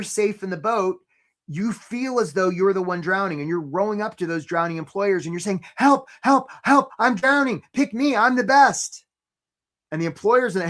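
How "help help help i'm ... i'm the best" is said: high, loud mock cries for help; hammed-up panicked pleading; desperate plea. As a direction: play a drowning person begging to be picked. Acting out desperate drowning job seeker.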